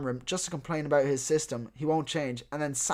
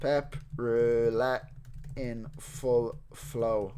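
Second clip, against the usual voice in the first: very slow, stretched-out typing voice; halting, word-by-word dictation to yourself; composing aloud; 3 semitones lower, much slower than usual. Real, not acted. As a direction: draw out each word as you type it. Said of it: "Let me go to my tweet".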